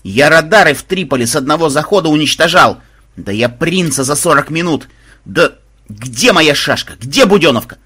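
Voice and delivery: loud, escalating indignant rant; blustering veteran's outburst; flaring up. A narrator's reading. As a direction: rage like an old warhorse. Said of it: "взвился аналитик".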